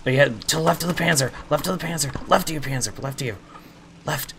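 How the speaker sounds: shouting directions fast and loud; urgent shout. Real, not acted. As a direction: yell it across the battlefield. Calling tank position in firefight.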